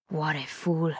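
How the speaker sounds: soft, dismissive villain voice; quiet contempt. A narrator's reading. Villain standing over captive before striking, quiet contempt. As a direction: dismiss them under your voice.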